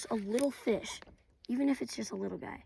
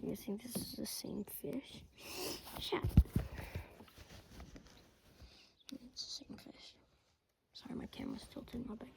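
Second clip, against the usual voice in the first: hushed whisper; 6 dB quieter than usual. Real, not acted. Whispering over the fish.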